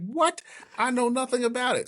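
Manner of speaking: high-pitched, incredulous protest; mock-stunned, voice shooting up; retold disbelief. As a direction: act out being caught totally unprepared. Retold shock at judge's order.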